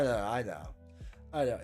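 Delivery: usual speaking voice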